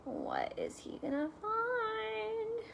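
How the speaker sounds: high, soft, slow teasing question; suspenseful question. Acted. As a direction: draw out the suspense.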